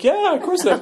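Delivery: a laughing wine expert going along with it; mock wine guy. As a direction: play the winery guy humoring him. Wine guy mocked agreeing.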